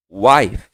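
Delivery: Wife, F dying on the lip; Wife with no vowel after the F; model pronunciation. Shown as a demonstration. Teacher models wife for students. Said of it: "Depois, esposa".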